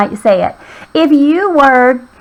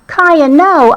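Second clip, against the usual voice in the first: higher-pitched call, not truly shouted; mild yell; 6 semitones higher, 3 dB louder than usual. Acted. Owner to dog jumping at a frog, mild yell. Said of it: "I yelled".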